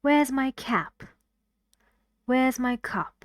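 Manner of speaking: "Where's my" light, then land on "cap" and "cup" with a fall; careful demo stressing final "cap" and "cup"; stress on last word. Shown as a demonstration. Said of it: "The stressed part is in here".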